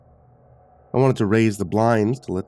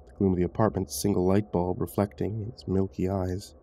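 ordinary delivery

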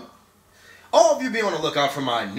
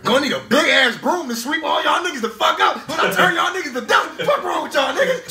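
mock hero boast; 10 semitones higher, 3 dB louder than usual. Boasting to the villains.

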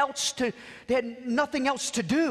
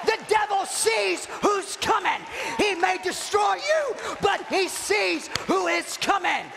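full-force preaching; 7 semitones higher than usual. Real, not acted. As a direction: preach it up to a fever pitch.

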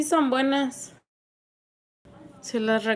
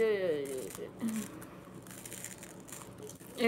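pained whimpers; 3 semitones lower, 12 dB quieter than usual. Real, not acted. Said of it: "casi me hace llorar".

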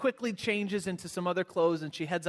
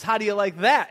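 louder, higher mock-angry wife; retold defiant jab; 4 semitones higher, 10 dB louder than usual. Wife getting even with her husband, loud and defiant. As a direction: hurl it out as payback, loud and raised.

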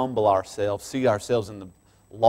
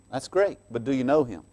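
normal talking voice

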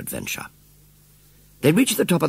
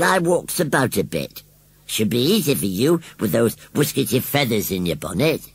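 higher, cheeky rustic voice; Gummidge; 8 semitones higher than usual. A narrator's reading. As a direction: tease her in broad country talk. Gummidge teasing the lady.

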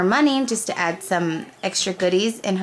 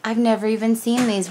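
ordinary talk, nothing marked

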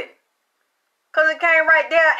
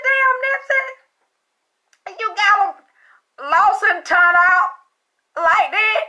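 much higher Damn, Nipsey, slowing on lost and turned out; mock astonished question; 8 semitones higher than usual. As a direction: tease him in high disbelief. Retold teasing question to Nipsey.

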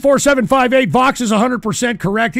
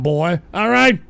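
ordinary talk

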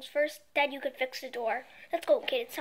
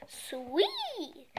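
ordinary delivery, nothing marked

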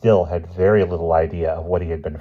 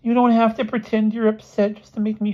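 high put-on boy's voice; plaintive, pitched well up; dejected schoolboy; 4 dB louder than usual. Expelled boy to his upset roommate, high and plaintive.

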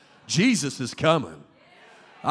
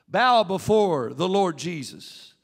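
ordinary talk

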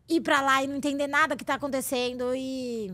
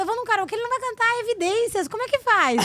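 much higher, laughing disbelief; laughing exasperation; 8 semitones higher than usual. Retold or imitated. Woman explaining the karaoke problem, high laughing exasperation. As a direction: retell it with a laughing, high protest. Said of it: "Eu falo".

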